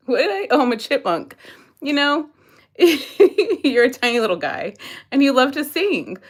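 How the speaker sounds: usual speaking voice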